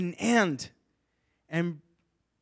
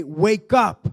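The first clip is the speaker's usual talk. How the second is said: louder, higher command; calling the listener to rise; 4 semitones higher, 7 dB louder than usual. Acted. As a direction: summon them up. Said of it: "Jesus is telling you today".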